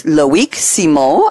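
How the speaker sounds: French pronunciation, ending on a nasal "Simon"; best attempt at a French accent. Shown as a demonstration. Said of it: "I'm doing my best French accent".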